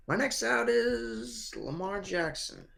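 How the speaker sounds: slow, bad Jersey accent. Shown as a demonstration. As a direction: drag it out in a shaky Jersey accent. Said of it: "I don't do a good Jersey accent".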